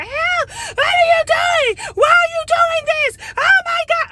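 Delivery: very high, slow, wailing shout; imitating her screaming. Imitating the neighbor's night screaming, shrill wailing shout. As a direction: caricature a hysterical woman yelling for help. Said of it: "'cause she was out there".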